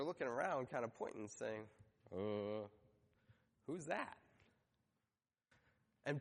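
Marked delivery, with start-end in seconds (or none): 2.1-4.2 imitated hesitant, softer questioning; puzzled undertone. Disciples looking around at each other, quietly puzzled. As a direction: draw out a low "uh", then ask softly.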